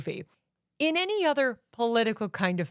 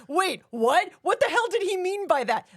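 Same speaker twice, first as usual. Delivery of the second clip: higher, quicker imitation of a confused crowd; mock bafflement; 8 semitones higher than usual. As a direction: act out everyone's startled double take. Everybody's baffled reaction, acted out.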